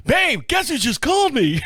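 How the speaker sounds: high, excited question to wife; bursting to tell; excited news. Retold or imitated.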